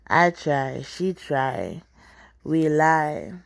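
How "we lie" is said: plain reading voice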